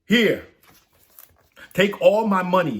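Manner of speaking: high, loud, desperate pleading king; desperate plea. Acted. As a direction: beg him to spare her, crying out. Desperate king bargains for daughter.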